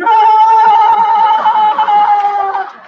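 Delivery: loud rapid-fire staccato ah-ah-ah; machine gun moan. Acted. Performer demonstrating the machine gun moan, loud and rapid. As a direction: fire the moans like bullets. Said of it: "machine gun moan".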